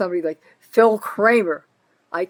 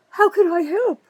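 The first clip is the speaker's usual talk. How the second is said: high, louder sing-song fake helpfulness; mocking the mayor; 11 semitones higher, 5 dB louder than usual. Mocking the mayor's phony offer of help, high and sarcastic. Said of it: "Well, Kramer".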